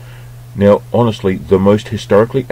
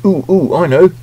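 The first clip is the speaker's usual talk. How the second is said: eager know-it-all; 11 semitones higher, 5 dB louder than usual. Pretend. Eager answer to trailer question.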